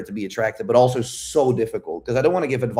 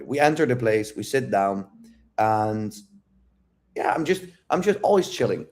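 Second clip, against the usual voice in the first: ordinary delivery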